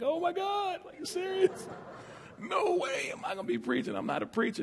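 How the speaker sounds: high, hushed mock panic in disbelief; retold disbelief. Telling the congregation his shock at the call, high-pitched mock panic. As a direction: squeak out disbelief at being asked to preach.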